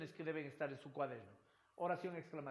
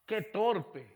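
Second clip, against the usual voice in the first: exclamation; 4 semitones higher, 12 dB louder than usual. Shown as a demonstration. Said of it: "varía la curva melódica de la voz".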